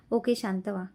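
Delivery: ordinary delivery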